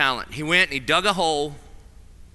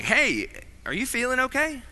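higher, gentle mock-worried check-in; tentative concern; 6 semitones higher, 4 dB quieter than usual. Friends to someone looking a mess, tentative concern. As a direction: imitate someone carefully asking after a friend.

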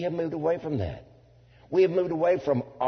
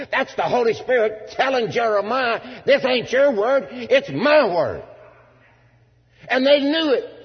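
high, loud, emphatic pulpit cry; full-force preaching; 11 semitones higher, 6 dB louder than usual. Real, not acted. Preacher voicing the Holy Spirit correcting Jeremiah, full-force preaching. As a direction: thunder it out like a divine correction.

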